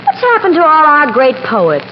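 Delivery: ordinary talk, nothing marked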